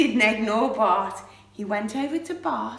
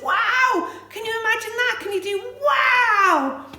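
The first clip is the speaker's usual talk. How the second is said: high, loud burst of wonder; wide-eyed storyteller excitement for children; amazed wow; 11 semitones higher, 7 dB louder than usual. Acted. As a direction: exclaim in big amazement, much higher. Acts amazed crowd, prompts children.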